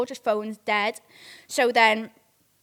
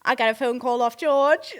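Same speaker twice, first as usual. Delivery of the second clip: normal talking voice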